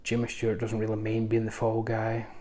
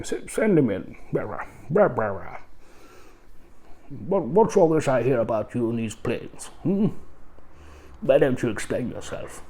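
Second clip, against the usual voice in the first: posh British official; 2 semitones higher, 3 dB louder than usual. Retold or imitated. Pipe-smoking British official summoning the pilot, pompous posh British voice. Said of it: "everybody talks like this".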